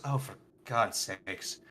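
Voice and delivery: a weary giant sighing it out; annoyed giant. Acted. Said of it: "and he goes".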